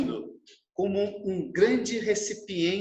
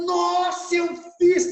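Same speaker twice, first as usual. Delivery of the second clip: much higher, louder, delighted gasp of discovery; amazed child; 11 semitones higher, 6 dB louder, slower than usual. Retold or imitated. Son discovering he drew the house himself, amazed high childlike voice. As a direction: marvel at what you just made.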